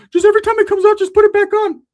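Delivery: high, loud excited squeal; excited Ricky. Retold or imitated. Ricky advising the homie.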